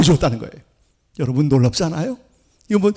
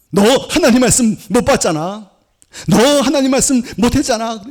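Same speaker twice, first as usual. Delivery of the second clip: acted reproach, pitched higher; accusing; 6 semitones higher than usual. Guilt nagging the believer over God's word, in mock reproach. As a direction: press the accusation home, raised in pitch. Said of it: "죄책감으로 막 누를 때가 있어요".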